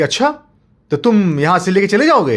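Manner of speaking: high, loud scornful monster voice; mocking monster. Monster taunts father who came for child, scornful. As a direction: taunt the little man.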